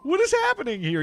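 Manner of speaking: high, loud disbelief; baffled protest. Retold or imitated. Streamer reacting to a gamer's odd boast, baffled protest. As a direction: halt everything with a high, incredulous cry.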